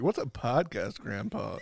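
put-on a grandkid asking an old man about podcasts; grandchild's question. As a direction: ask grandpa innocently. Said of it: "and they're like".

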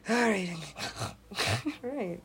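normal talking voice

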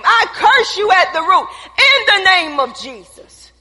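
loud commanding rebuke of covid; laying a curse. Acted. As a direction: curse it at the root, shouting. Rebuking the virus in Jesus' name.

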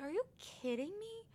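high-pitched incredulous retort; frustrated disbelief. Retold or imitated. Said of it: "I was so frustrated".

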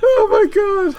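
very high, tearful laughing gasp; helpless laughter. Real, not acted. Host breaking down at his own bit, helpless laughter. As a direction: squeal it through laughter.

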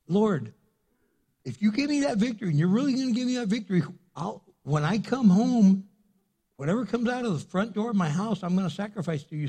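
high, fast pleading to God; a desperate leader bargaining with the Lord, high and fast; eager bargaining. Retold or imitated. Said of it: "So he's going".

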